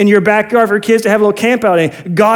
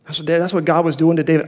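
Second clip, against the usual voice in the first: ordinary talk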